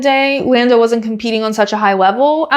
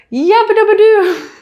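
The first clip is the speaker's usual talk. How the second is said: mock cheer; 11 semitones higher than usual. Driver celebrates the win with cartoon-style cheer. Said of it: "his response was".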